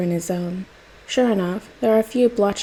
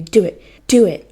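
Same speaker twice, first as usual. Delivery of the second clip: building, much louder shouts; goading shout; 8 dB louder than usual. A narrator's reading. Jason goading Bruce to hit him, shouting. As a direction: push him, louder each time.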